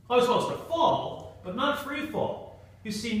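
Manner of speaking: usual speaking voice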